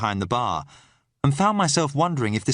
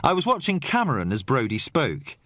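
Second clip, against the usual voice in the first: ordinary delivery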